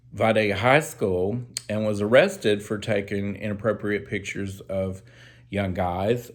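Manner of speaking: ordinary talk, nothing marked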